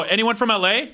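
calling to crowd. Retold or imitated. Comic asks who's from L A, calling out.

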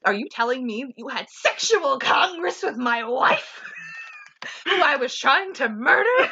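mock indignant yell breaking into a laugh; hammed-up outraged Bruce Willis. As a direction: ham up the outrage. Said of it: "Bruce Willis goes".

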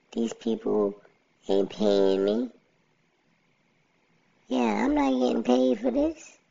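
high squeaky funny voice; goofy high cartoon voice. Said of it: "So I'ma use a robotic and funny voice".

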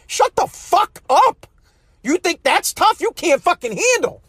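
high-pitched, indignant dismissal; heated scolding. Acted. Scolding an imagined complainer.

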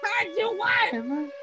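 high, slow mock panic cry; mock alarm. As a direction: cry a hammed-up warning.

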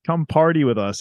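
airy, unbothered mock greeting; mock nonchalance. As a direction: imitate someone casually welcoming a newcomer. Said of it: "everyone else was just like".

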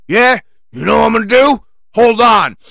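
big blustering mock tough guy; hard-ass voice. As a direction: mimic a hard case making a threat. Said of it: "with a hard-ass voice".

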